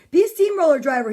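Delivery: brisk storybook quote, run together; fast, projected suggestion; quick read. A narrator's reading. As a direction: say it straight through, quickly. Same line read at quicker pace.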